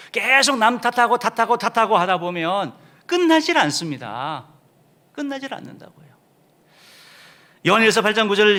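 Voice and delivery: high, loud climactic sermon; fiery preaching. Acted. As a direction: drive the point home from the pulpit. Preaching at full force.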